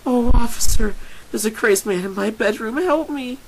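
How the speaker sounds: very high, loud helpless plea; squealing mock victim; mock damsel in distress. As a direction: cry for help like a hammed-up damsel. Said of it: "as if you were a damsel in distress".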